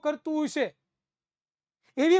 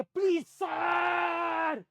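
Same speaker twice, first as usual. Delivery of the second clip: begging student; 4 semitones higher, 5 dB louder, slower than usual. Retold or imitated. Pleading student voiced.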